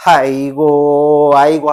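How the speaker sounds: slow, loud drawn-out 아이고 wail with no tears; mock mourning wail. Teacher imitates tearless mourners at a rite, slow loud mock wail. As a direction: stretch each 아이고 into a long, loud funeral keening. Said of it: "하는데 눈물은 안 흘려요".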